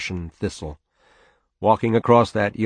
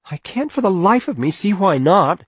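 high, louder voice of an exasperated wife; protesting mother; 11 semitones higher, 7 dB louder than usual. Acted. Mother arguing with father inside the house, high exasperated protest. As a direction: plead the point, pitched well up.

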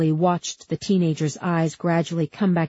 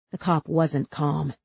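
usual speaking voice

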